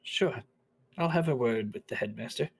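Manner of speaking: put-on an older woman seeing through his excuse; knowing old lady. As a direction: tease him gently, then offer help. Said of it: "She says".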